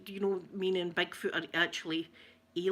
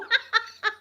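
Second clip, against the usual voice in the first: loud high cackle of triumph; high burst of laughter; 14 semitones higher, 7 dB louder than usual. Real, not acted. Hearing the coworker admit it was horrible, loud high laugh. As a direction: laugh out, vindicated. Delighted at his confession.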